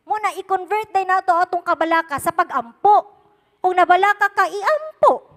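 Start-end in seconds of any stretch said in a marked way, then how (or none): 3.6-5.2 rising, loud preacher's call to pray; preaching at full force. Acted. Preacher urging the congregation, loud and high.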